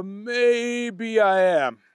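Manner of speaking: very slow, drawn-out sheepish reply; sheepish slow confession; slow admission. A narrator's reading. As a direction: drag it out guiltily. Booger admits slowly.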